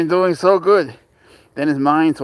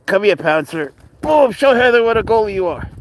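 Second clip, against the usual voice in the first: excited urging; 6 semitones higher, 4 dB louder than usual. Real, not acted. Urging dog to show off.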